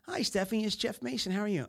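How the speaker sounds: high, bright salesman phone voice; cheery phone greeting. Retold or imitated. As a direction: lightly act out an upbeat sales call. Voicing himself calling customer.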